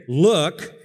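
loud, scornful look; disdain. Shown as a demonstration. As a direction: spit it out with contempt. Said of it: "complete disdain".

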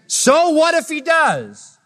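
very high, slow, drawn-out retort; voicing Jesus. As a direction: stretch it out dismissively. Quoting Jesus again.

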